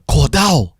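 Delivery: loud, high call of the name; mum's shout. Retold or imitated. Mum calling her son downstairs, shouting. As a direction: holler for your son up the stairs.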